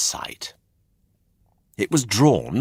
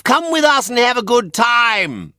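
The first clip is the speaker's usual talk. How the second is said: very high, loud group yell; boys shouting; 7 dB louder than usual. A narrator's reading. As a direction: shout them back to the coach. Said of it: "shouted all the boys together".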